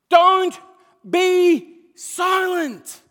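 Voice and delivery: "Don't be silent" high, very loud, each part stretched; shouted command. Real, not acted. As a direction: proclaim "Don't be silent" at full force with pauses. Preacher proclaims the command at full force.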